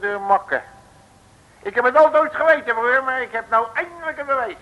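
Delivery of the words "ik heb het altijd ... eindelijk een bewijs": higher, louder, fast, gloating over the proof; triumphant. Acted. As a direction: crow about finally catching him.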